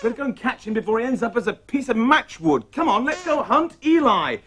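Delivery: ordinary talk, nothing marked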